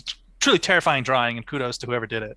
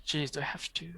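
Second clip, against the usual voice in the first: whispering; 11 dB quieter than usual. Real, not acted. Reluctant whisper when asked to pick.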